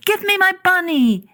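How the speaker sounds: put-on a child reaching out for his toy; boy pleading. Boy in bed stretching out his hands, pleading high. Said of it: "he said".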